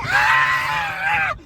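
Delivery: piercing high scream of relief; high yell. Retold or imitated. Safely back from the kitchen, shrieking like a little kid. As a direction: let out a kid's shriek.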